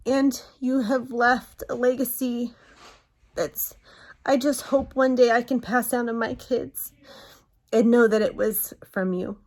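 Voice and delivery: fighting not to cry as she says it; emotional, near tears. Real, not acted. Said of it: "I'm not gonna cry".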